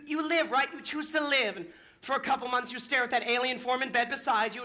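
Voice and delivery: normal talking voice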